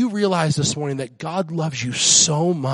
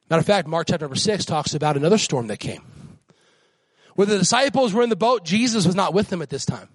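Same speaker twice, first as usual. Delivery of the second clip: normal talking voice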